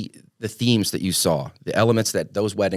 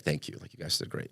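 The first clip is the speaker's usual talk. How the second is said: quoting the client's thanks in a breathy whisper; hushed grateful thanks; 7 semitones lower, 14 dB quieter than usual. Retold or imitated. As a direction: pass on their thanks softly, almost a whisper. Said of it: "were like".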